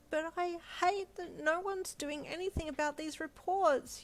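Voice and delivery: high, slow crying yell; tearful yell. A narrator's reading. Wife yelling through tears to husband, high slow tearful yell. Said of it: "you yelled".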